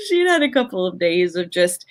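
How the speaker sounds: talking through a laugh; amused laugh as she starts the story. Real, not acted. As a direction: let the laugh spill into the words. Still amused telling story.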